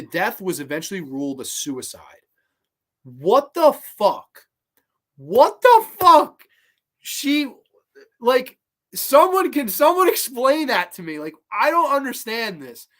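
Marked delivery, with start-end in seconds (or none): 3.0-4.5 slow, louder disbelieving what the fuck; real disbelief, drawn out; stunned. Real, not acted. Reacting in disbelief to death report. 5.2-6.4 high, loud, slow shocked shriek; real outraged disbelief, pitched way up. Real, not acted. Repeats reaction, much more intensely. 7.0-12.9 higher, louder exasperated plea; real baffled exasperation; incredulous plea. Real, not acted. Incredulous plea after reading report.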